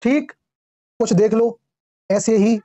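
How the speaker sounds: usual speaking voice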